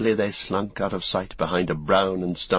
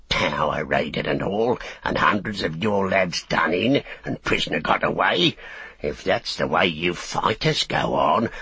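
orc tracker. Acted. Tracker orc taunting soldier.

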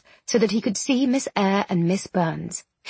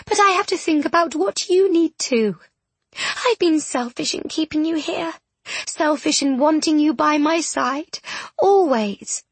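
higher voice, growing more upset; tearful tenderness; 7 semitones higher than usual. A narrator's reading. Miss Eyre confessing selfishness to her friend, tearful. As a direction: build from gentle to pained, pitch climbing.

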